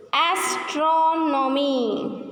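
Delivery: "Astronomy" very slow, second syllable stretched; stressed syllable demo. Shown as a demonstration. Teacher shows students how to stress it, "Astronomy" drawn out on "tron". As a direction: lean long on the "tron" of "Astronomy". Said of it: "How do we stress it?".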